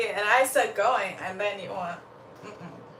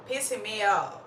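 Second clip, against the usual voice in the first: ordinary talk, nothing marked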